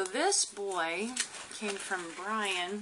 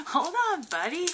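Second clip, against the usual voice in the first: pet talk; 10 semitones higher, 6 dB louder than usual. Real, not acted. Talking to the new lizard.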